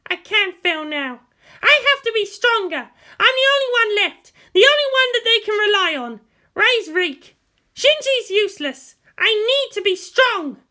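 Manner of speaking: put-on proud girl refusing to be weak; defiant teenage girl. Pilot girl telling herself she must be strong, defiant.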